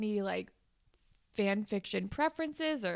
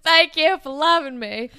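sudden loud outburst; 4 semitones higher, 11 dB louder than usual. Acted.

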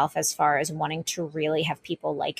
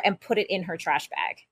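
usual speaking voice

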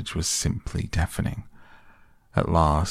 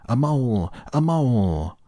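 high shouted battle cry; war cry; 7 semitones higher than usual. Acted. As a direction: yell it as you charge in. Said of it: "shouting his awful war cry".

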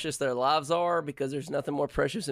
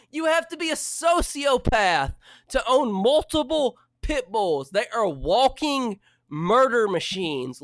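louder, higher, hammed-up indignant tirade; mock outrage rant; 6 semitones higher, 5 dB louder than usual. Real, not acted. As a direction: preach against pit bull owners at full volume. Ranting about pit bull owners.

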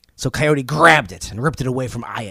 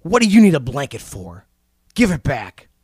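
Coyote demands his blanket back from the rock, pushy and louder; demanding coyote; 3 dB louder than usual. Acted. Coyote confronts the rock over the blanket, pushy. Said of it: "Coyote went to Aya".

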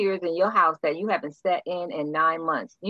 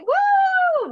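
high, loud mock cheer of joy; playful imitation of an emotional high; mock whoop; 8 dB louder than usual. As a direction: whoop like you're on top of the world. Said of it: "We're gonna be like".

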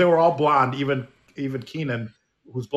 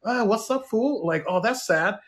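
put-on higher-pitched California accent; 7 semitones higher, slower than usual. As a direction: imitate her California drawl, pitched up. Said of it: "sometimes she would say".

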